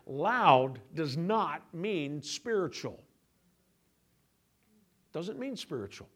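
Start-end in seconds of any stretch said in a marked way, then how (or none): 0.1-3.0 each word spaced and weighted, "Loud" stretched out; slow emphatic. Shown as a demonstration. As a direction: lay it down word by word. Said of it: "slow emphatic key line".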